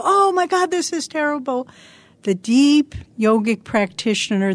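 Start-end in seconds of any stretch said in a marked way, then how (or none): 0.0-1.7 mock panic. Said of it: "most people would go".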